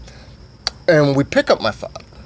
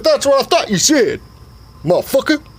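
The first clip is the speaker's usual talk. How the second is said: hammed-up high daddy voice; father voice; 10 semitones higher than usual. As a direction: play the pushy old dad, pitched way up. Dad's triumphant comeback.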